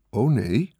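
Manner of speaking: plain reading voice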